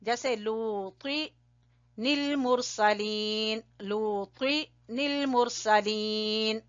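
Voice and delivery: लूती निल मुरसलीन chanted very slowly, with the noon joined to निल; slow Quranic recitation. Shown as a demonstration. Teacher demonstrating noon qutni, slow recitation. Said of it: "तो फिर हमजातुल वसल नहीं पढ़ा जाएगा".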